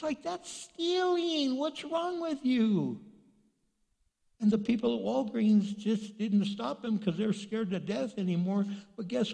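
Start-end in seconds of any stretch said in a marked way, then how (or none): 0.0-3.0 slow, high-pitched reproach; incredulous scolding. Acted. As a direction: scold slowly in high disbelief. Mock scolding of shoplifters.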